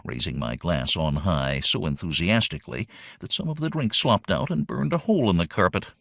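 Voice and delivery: ordinary delivery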